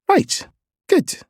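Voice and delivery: high, clipped relieved woman; quick relief from the aunt. A narrator's reading. Aunt reacts.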